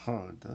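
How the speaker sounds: heard said "herd", with a released d; one er vowel in heard, no ee; correct pronunciation. Shown as a demonstration. Teacher repeats heard for students, careful pronunciation. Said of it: "이렇게 읽어요".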